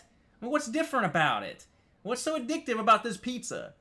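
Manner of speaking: high, loud, ranting; a building fed-up tirade; exasperated rant. Real, not acted. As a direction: vent your outrage at overhyped pizza. Ranting about pizza.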